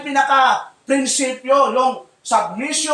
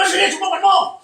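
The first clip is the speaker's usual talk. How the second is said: higher mock-angry dare; challenging yell; 7 semitones higher than usual. Pastor imitating a high-blooded man daring someone, mock shouting. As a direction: throw out a hot-headed challenge. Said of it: "gumaganon ka na".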